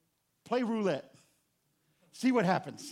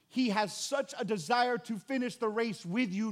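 ordinary delivery

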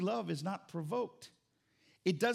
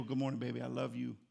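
low, soft loving good morning; softly sweet to his wife; tender morning greeting; 9 semitones lower, 5 dB quieter than usual. Retold or imitated. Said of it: "I go".

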